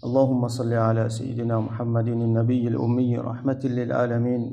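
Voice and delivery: low, rapid Arabic recitation; formal opening invocation. Real, not acted. Preacher opening a religious lesson with praise and greeting, formal recitation. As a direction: recite the blessings quickly in a subdued, low voice.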